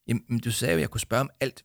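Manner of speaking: ordinary delivery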